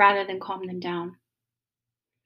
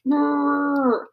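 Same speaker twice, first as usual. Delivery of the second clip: a mock frustrated "Brrr" growl, higher; 5 semitones higher than usual. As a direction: imitate the husband's annoyed grunt as he walks in. Frustrated husband storming in.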